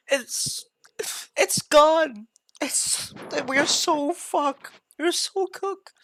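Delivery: loud, high wail of fake despair; hammed-up grief over the lost feature; mock despair. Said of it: "makes me so sad".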